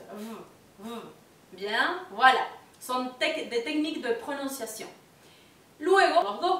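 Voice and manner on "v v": "V" buzzed with upper teeth on lower lip; French v. Shown as a demonstration. Teacher showing the v: teeth on lip, buzzing. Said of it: "los dientes superiores toquen los labios".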